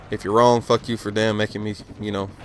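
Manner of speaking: ordinary delivery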